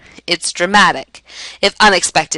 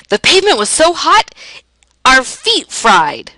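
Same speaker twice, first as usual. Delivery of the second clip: overstate it, drawing out FEET FRIED; dramatic exaggeration; 7 semitones higher than usual. Shown as a demonstration. Teacher giving a hyperbole example about hot pavement, dramatically exaggerated.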